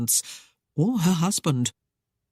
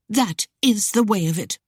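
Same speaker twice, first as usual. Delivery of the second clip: higher bitter finality; bitter ire; 5 semitones higher than usual. A narrator's reading. Lady bitterly ending it with her suitor. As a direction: close the door on him with bitterness. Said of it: "The words burst out in bitter ire".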